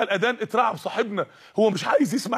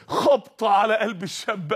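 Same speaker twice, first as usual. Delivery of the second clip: ordinary delivery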